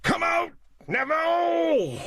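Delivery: a very high, drawn-out shout from outside; shouting Cortman. A narrator's reading. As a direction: howl his name to lure him out. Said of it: "he heard Cortman shout".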